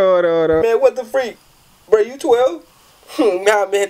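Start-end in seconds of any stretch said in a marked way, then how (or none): none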